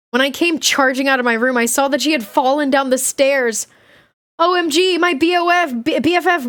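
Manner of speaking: heightened, animated diary reading; lively dramatic read-aloud; story narration. A narrator's reading.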